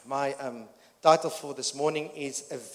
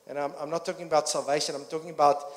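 usual speaking voice

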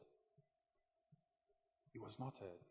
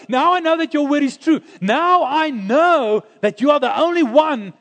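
much higher and louder, triumphant declarations; full-force preaching; 12 semitones higher, 9 dB louder than usual. Acted. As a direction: proclaim each line to the back row.

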